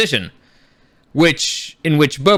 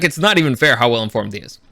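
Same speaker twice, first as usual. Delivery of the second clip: ordinary delivery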